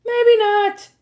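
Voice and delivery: high, loud yelp; comic panicked yell; alarmed correction. A narrator's reading. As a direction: shout a hasty retraction. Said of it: "Grover corrected".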